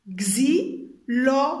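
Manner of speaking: XYLOPHONE as xy, lo, fo, ne, loud separate beats; syllable by syllable. Shown as a demonstration. Teacher tapping four syllables for pupils, each separate. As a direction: slowly count out xylophone in four pieces. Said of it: "Quatre syllabes".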